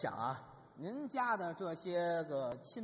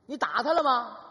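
a much louder, higher hammed-up demand; mock yell; 5 semitones higher, 11 dB louder than usual. Husband shouting at his wife over the thugs, mock yell. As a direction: bark the question at her like a fuming husband.

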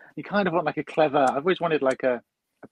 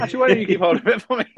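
fast, high and louder through a laugh; laughing mock request; 8 semitones higher, 6 dB louder, faster than usual. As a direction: rattle it off cheekily while laughing. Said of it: "you just kinda go".

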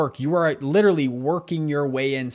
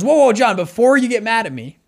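loud, higher, rushed to head off anger; raised voice, pleading to calm things down; urgent plea; 4 semitones higher, 4 dB louder than usual. Retold or imitated. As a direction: call out to stop a partner blowing up. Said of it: "He goes".

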